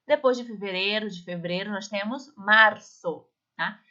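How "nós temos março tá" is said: normal talking voice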